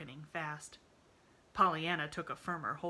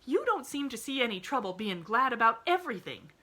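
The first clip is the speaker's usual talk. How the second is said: retorting; 7 semitones higher, 8 dB louder than usual. A narrator's reading. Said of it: "retorted Nancy, choking a little".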